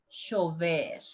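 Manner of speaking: chover as "shover", for llover; sh pronunciation. Shown as a demonstration. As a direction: start chover on a hushing sh. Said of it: "with the three different forms".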